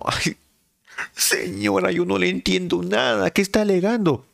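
high mocking voice; mocking confused caller. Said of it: "fue así como". (imitando muy agudo a una persona confundida)

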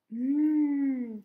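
a big appreciative mmm; loud sniffing hum. Real, not acted. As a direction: hum loudly as you smell it. Smelling the sticker.

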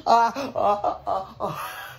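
high-pitched burst of cackling; hysterical laughing. Acted. Bystander hears gas is going into a Tesla, hysterical laughing. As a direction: laugh helplessly in someone's face. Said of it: "why are you laughing".

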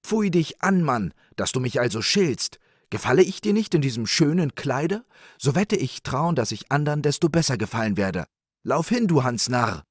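high, loud indignant scolding; shouting wife. A narrator's reading. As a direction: hurl insults back at your husband. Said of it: "schrie die Frau".

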